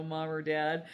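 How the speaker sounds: ordinary talk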